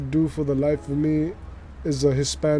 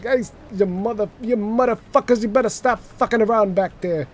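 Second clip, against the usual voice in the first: higher-pitched wise guy dad telling off; mock fatherly yelling; 7 semitones higher than usual. Father yelling at kids in the back, mock-angry wise guy. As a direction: act out an exasperated father scolding. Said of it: "my father's yelling at us".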